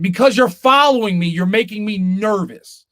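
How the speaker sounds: high, loud exasperated retort. Retold or imitated. Driver to cop following him, exasperated. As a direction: protest it, drawing out the end.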